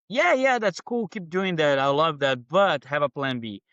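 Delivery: high, loud, breezy yeah-yeah then steadying; mock family voice. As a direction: imitate the family cheerfully brushing it off. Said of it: "It was like".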